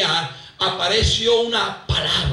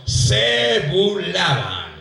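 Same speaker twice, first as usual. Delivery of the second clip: said slowly so the church echoes it; slow, drawn-out call and response; much slower than usual. Shown as a demonstration. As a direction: stretch "se burlaban" slowly for the congregation to repeat. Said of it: "Digan conmigo". (lento, para que la iglesia lo repita)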